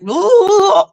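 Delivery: high, drawn-out imitated "ooh"; mock riled-up ooh. Creator imitating the man's reaction to trash talk, mock outrage.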